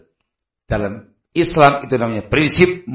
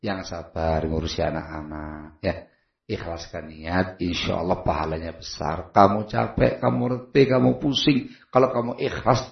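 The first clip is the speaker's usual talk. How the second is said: gentle advice; 3 semitones lower, 7 dB quieter than usual. Retold or imitated. Husband softly counseling his tired wife, low and quiet. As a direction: soothe her quietly.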